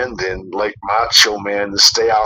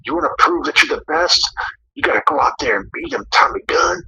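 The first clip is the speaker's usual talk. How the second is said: higher, quick imitation of an egging-on manager; lightly voiced goader; 11 semitones higher, faster than usual. Retelling a guy in Tommy's ear, mock goading. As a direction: push him on in a raised, hurried voice. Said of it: "that guy got in his ear and told him".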